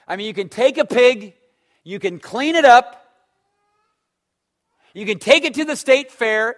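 high, loud pulpit preaching; fiery sermon delivery, pressing each "know"; full-force preaching. Acted. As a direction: hammer each line home from the pulpit.

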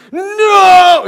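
high, loud drawn-out groan; crowd yelling. Retold or imitated. Preacher imitating Dallas fans dreading the shot, loud long shout. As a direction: cry it out like a dismayed fan. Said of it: "all the Maverick fan goes".

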